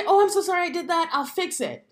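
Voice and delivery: quick, high, gushing mock apology; over-apologetic. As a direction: ham up the nervous over-apologizer. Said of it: "Don't say".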